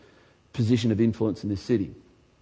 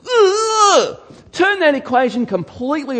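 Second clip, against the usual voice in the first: impassioned cry; 7 dB louder than usual. Real, not acted. Preacher crying out to the congregation. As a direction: break into a pleading shout. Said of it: "preacher cries out urging".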